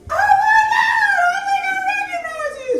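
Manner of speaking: very high, gushing fan voice; breathless high-pitched fan; starstruck fan. Retold or imitated. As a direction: squeal it like an excited stranger. Woman recognizing Tina, high voice.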